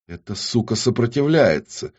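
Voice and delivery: ordinary delivery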